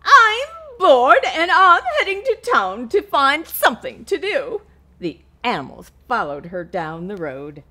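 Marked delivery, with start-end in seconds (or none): 0.0-4.6 loud, high squawk; high squawking hen voice. Acted. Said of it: "the hen squawked".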